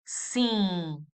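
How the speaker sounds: "Sim" with a long nasal vowel. Shown as a demonstration. Teacher showing the word for yes, very nasal "Sim". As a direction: draw out "Sim" through the nose, lips open. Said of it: "very nasal".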